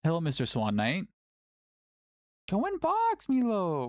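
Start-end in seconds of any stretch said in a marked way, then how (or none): 2.5-3.9 put-on baby talk to cat. Coaxing the cat.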